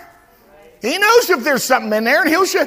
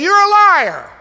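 louder, higher accusation; defiant rebuke; 8 semitones higher, 6 dB louder than usual. Shown as a demonstration. Preacher showing how to rebuke Satan, loud and defiant. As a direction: call the enemy a liar to his face. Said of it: "turn around and say".

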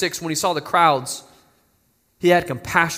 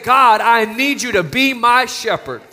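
louder and higher, pleading prayer; fervent plea; 5 semitones higher, 4 dB louder than usual. Shown as a demonstration. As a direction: call out to God with urgency. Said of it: "we need to say".

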